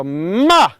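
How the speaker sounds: loud ma thrown outward; projected ma. Shown as a demonstration. Coach showing the group the ma sound, projected. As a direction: shoot ma across the room. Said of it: "make an mm sound".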